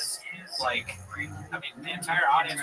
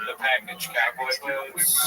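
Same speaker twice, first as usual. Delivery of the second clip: normal talking voice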